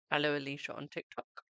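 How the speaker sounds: usual speaking voice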